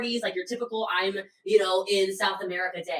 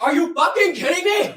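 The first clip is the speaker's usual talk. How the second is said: much louder, high-pitched incredulous yell; outraged shout; 9 semitones higher, 10 dB louder than usual. Retold or imitated. Partner confronts diabetic over candy bar, outraged shout. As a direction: imitate someone exploding in disbelief. Retold angry outburst by Sasha.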